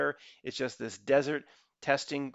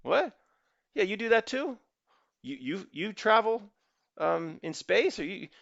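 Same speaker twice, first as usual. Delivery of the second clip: playing a stunned onlooker, pitched up; astonished question; 8 semitones higher than usual. Man from the movie scene asks the astronaut, amazed and high. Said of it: "You go".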